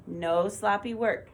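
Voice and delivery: usual speaking voice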